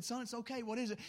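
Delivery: put-on soft, gentle reassurance to a child; calm him down softly; reassuring father. Father comforting his crying son, soft and reassuring. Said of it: "I said".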